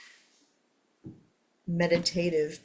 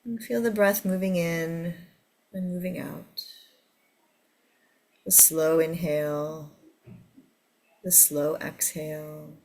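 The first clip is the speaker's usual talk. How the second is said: guided meditation. Acted. Leads a meditation.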